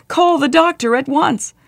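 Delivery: high, distressed plea; wailing. A narrator's reading. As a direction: wail for urgent help. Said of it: "she wailed".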